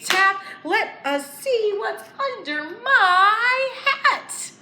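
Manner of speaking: high, loud and thrilled, with a laugh; excited magic reveal. Acted. Teacher reveals the magic hat to the children, high and excited. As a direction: sell the surprise under the hat.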